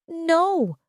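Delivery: high, sharp mock scream. A narrator's reading. Mother denying the boy is another man's, screamed. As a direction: cry out a denial. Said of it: "Vanessa screamed".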